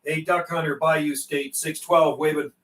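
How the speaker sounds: usual speaking voice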